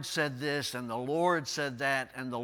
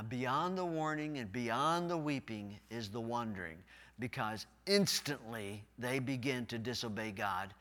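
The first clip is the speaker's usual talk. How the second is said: ordinary delivery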